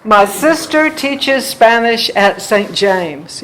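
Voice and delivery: "my sister teaches Spanish at Saint James" slow and loud, beat on SIS, TEACH, SPAN; "my sister teaches Spanish at Saint James" as a loud, measured beat; marked rhythm. Shown as a demonstration. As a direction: punch the first syllables of "sister", "teaches", "Spanish". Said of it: "You hear that one?".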